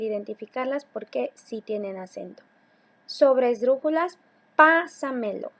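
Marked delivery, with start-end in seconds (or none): 4.6-5.5 "pásamelo" with a loud PÁ, then samelo; teacher's emphatic first syllable in "pásamelo"; stressed first syllable. Shown as a demonstration. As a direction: hit the PÁ of "pásamelo" hard. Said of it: "antes de la antepenúltima".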